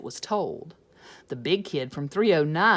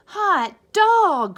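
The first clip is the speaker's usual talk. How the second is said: much higher, much louder cry; excited cry; 12 semitones higher, 13 dB louder than usual. Acted. Class cheering the principal is gone, loud and high. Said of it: "cried the class".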